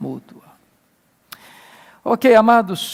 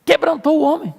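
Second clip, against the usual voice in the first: imitating an elated preacher, pitched way up; thrilled pastor; 10 semitones higher than usual. Pastor delighted that his sermon moved the judge, excited. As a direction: gush in a high, hammed-up voice. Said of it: "ficou todo empolgado".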